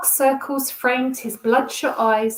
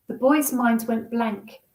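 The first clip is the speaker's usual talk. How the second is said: plain reading voice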